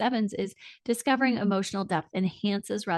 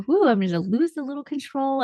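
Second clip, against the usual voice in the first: letting go; 6 semitones higher, 4 dB louder than usual. Pretend. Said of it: "allowing yourself to".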